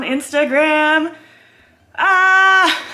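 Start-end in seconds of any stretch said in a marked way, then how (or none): 2.0-2.8 high, loud anticipatory squeal; excited scream. Real, not acted. Vlogger waiting for twelve o'clock, excited scream. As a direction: shriek with excited nerves.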